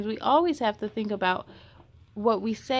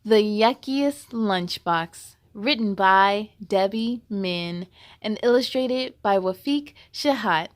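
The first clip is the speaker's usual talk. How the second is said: title read; 4 semitones lower, 7 dB louder than usual. Acted. Formal title reading.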